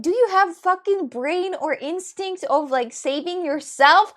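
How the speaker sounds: loud, slow, high-pitched scolding; drawn-out incredulous shout; retold exasperated yell. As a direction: drag out each word in loud mock disbelief. Acting out her scolding of rider.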